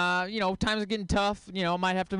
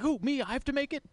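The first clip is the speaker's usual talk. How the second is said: higher, surprised who, me; Guy Fieri; 9 semitones higher than usual. Retold or imitated. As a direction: play a startled celebrity chef pointing at himself. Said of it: "Guy Fieri being like".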